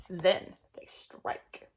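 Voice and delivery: slow, suspenseful whisper; dramatic whisper. Acted. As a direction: drop to a hush and draw it out. Dramatic line in animal book.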